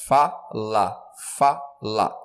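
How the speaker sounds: "fala" split slowly into fa, la; sílabas separadas. Shown as a demonstration. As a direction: pull "fala" apart syllable by syllable. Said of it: "O mais forte é o fá". ("fala" separada em sílabas, fa... la)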